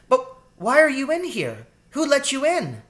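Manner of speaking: put-on high, piping child's voice; suspicious girl rat. A narrator's reading. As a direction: demand answers in a high little-girl voice. Suspicious child rat questions intruder.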